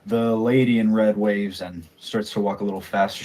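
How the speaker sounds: ordinary talk, nothing marked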